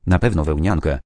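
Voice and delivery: ordinary delivery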